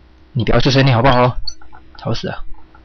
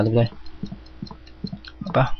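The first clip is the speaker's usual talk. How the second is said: usual speaking voice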